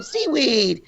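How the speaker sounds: light mock kid chant of seaweed; sing-song it like a child scooping; lightly voiced kids playing; imitating kids. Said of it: "like this".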